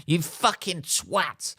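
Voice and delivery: "You fucking twat" slow and high; mock insult. Mocking his own earlier outburst, slow. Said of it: "I instantly went from".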